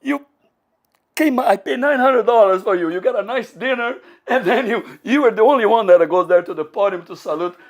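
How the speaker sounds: high, loud mock outburst; indignant scolding. Friend who paid for the dinner scolding him, high and indignant. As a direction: berate him in exasperated disbelief.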